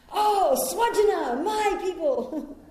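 high, slow, delighted cry; joyful recognition. Retold or imitated. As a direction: greet them like long-lost family. Delighted to meet fellow Americans.

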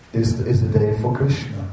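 ordinary talk, nothing marked

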